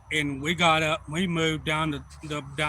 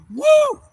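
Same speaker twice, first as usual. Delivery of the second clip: high, loud "woo"; imitated hollering, one long high whoop; celebratory whoop; 15 dB louder than usual. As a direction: whoop it up over a big catch.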